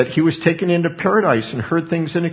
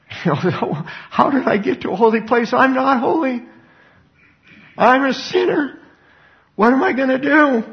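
a sinner suddenly terrified before God; Isaiah in a high panic at his own sin; panicked Isaiah; 9 semitones higher than usual. Acted.

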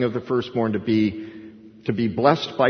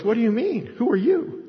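high-pitched, startled questioning; acting out a baffled old father; bewildered Isaac; 10 semitones higher than usual. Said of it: "And Isaac goes".

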